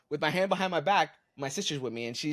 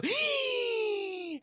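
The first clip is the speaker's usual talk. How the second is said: high inhaled squeal, laughing; shocked gasp; 3 dB quieter than usual. Shown as a demonstration. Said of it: "they inhale and make that sound".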